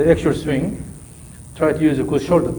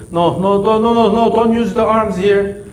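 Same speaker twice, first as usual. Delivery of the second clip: rising alarm into a firm warning; urgent correction; 9 semitones higher, 6 dB louder than usual. Real, not acted. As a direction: stop the student fast, alarmed. Coach stops a wrong swing.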